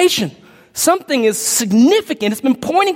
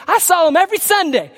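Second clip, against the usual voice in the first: higher, lightly acted vouching voice; a hint of a protesting pastor; lightly voiced pleading pastor; 6 semitones higher than usual. Pastor vouching for a churchgoer before God, lightly pleading.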